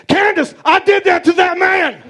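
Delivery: very high, loud victory shout to the ex; hammed-up declaration of love; triumphant shout. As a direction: scream it across the room at her. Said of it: "I said".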